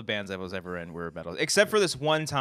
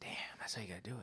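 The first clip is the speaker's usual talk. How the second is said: whispering; 18 dB quieter, much faster than usual. Real, not acted. Aside while friend tells story.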